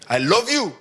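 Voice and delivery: loud, laughing I love you; mocking I love you. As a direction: laugh it out so nobody believes you. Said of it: "the kind of love that comes with that face".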